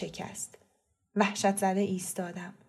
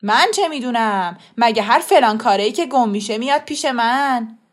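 loud, indignant protest; angry denial; 2 semitones higher, 10 dB louder than usual. A narrator's reading. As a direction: shout back, rejecting the accusation. Shopkeeper denying in quarrel. (اعتراض بلند و عصبانی)

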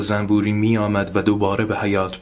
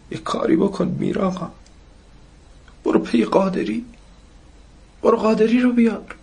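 high-pitched, anguished plea; desperate pleading; 12 semitones higher than usual. Acted. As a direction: beg for help in a high, distressed voice. Mother begs help for sick Taher.

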